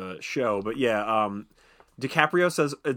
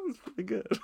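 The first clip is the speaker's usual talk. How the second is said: laughing; 5 dB quieter than usual. Real, not acted. Laughing at friend's accent.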